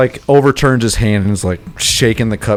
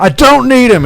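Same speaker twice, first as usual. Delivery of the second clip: loud angry snap; angry outburst; 13 semitones higher, 7 dB louder than usual. Acted. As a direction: yell it in defiance. NPC angrily slams mug.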